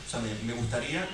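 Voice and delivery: normal talking voice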